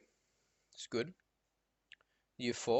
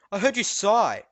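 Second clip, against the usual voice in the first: louder, higher accusing teacher voice; scolding teacher, hammed up; mock stern teacher; 7 semitones higher, 9 dB louder than usual. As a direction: imitate the teacher insisting, raised. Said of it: "And she's like".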